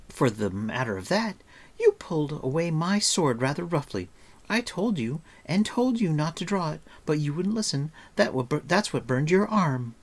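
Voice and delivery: put-on high, emphatic girl's scolding; indignant, chattering girl; reproachful girl. A narrator's reading.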